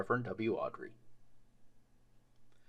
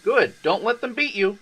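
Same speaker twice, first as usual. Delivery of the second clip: encouraging; 10 semitones higher, 5 dB louder than usual. Acted. Edward encourages James.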